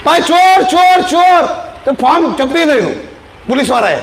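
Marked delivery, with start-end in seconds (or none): 0.0-1.5 imitating a yell of ચોર, raised and higher; mock alarm cry, voice pushed up; retold cry for help, half-acted; retold shout of thief. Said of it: "અને બૂમ મારી".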